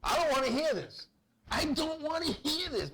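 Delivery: put-on fed-up kid. Child escaping dad's drunken lecture, high exasperated protest.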